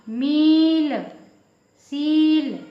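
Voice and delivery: मील and सील with loud, drawn-out ee; long pressed ee. Shown as a demonstration. Teacher showing the big matra, long loud vowel. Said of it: "इसको दबाकर बोला जाता है".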